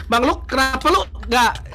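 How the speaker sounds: usual speaking voice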